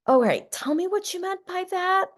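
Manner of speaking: high, sing-song curiosity, holding back the annoyance; a partner forcing calm curiosity instead of snapping; curious despite irritation. Retold or imitated. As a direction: swallow the irritation and ask sweetly. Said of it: "If I'm, like, a little irritated".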